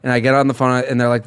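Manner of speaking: ordinary talk, nothing marked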